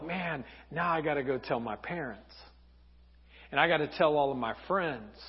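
low, soft, crushed thinking aloud; brokenhearted. Acted. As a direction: mutter it to yourself, humiliated. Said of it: "He's brokenhearted".

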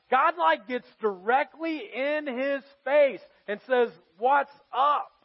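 high, loud sermon delivery; full-force preaching. Acted. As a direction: preach it with fire.

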